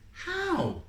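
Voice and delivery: put-on a little boy asking; little brother. Little brother asks in story.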